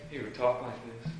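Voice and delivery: very soft, low voice, barely audible; scared student teacher. Retold or imitated. Teacher mimicking his scared student teacher, timid and hushed. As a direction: imitate a frightened new teacher speaking tiny and quiet. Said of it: "that's how he talked".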